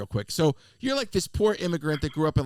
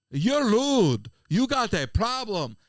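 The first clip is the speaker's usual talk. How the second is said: higher, louder, drawn-out imitation Jamaican accent; botched Jamaican accent; 6 semitones higher, 5 dB louder, much slower than usual. Scolding a flight attendant on a plane, imitated Jamaican accent. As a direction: put on a Jamaican accent that slips toward Cuban. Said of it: "I sounded more like Ricky Ricardo".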